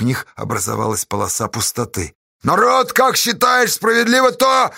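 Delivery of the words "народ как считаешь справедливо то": high, loud rallying shout; angry appeal. A narrator's reading. Pozdey appealing to the bargemen, angry shout. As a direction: demand the crowd's verdict. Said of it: "Поздей гневно осмотрел Бурлаков".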